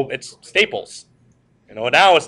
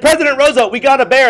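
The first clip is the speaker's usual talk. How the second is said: higher and louder, proud of the catch; excited hunters; 10 semitones higher, 6 dB louder, faster than usual. Retold or imitated. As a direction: announce it like you've done the boss a favour. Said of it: "So they said".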